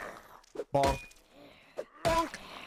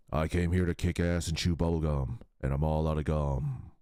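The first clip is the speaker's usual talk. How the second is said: much lower and fast; deep action hero quip; 13 semitones lower, faster than usual. Retold or imitated. Quoting the game hero, fast deep voice. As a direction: rattle off a tough guy line.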